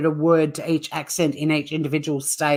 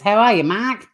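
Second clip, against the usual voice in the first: mock Boston greeting, Mark high; playful Boston accent calling Mark; Boston bird-noise Mark; 5 semitones higher than usual. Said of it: "bird noises".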